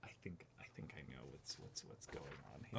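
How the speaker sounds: breathless unvoiced whisper of realization. Real, not acted. Unboxing to co-host, whispering a dawning realization. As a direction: hush it as the penny drops.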